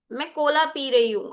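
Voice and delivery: normal talking voice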